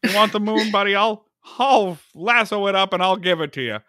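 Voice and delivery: woo her like a small-town hero; Jimmy Stewart impression. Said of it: "more of my Jimmy Stewart impression".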